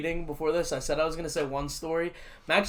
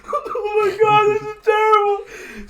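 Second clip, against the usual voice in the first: mock drunk wailing; 10 dB louder than usual. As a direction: stretch it out like a sobbing drunk. Retelling crying drunk.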